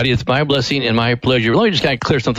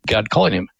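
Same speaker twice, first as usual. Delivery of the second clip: ordinary talk, nothing marked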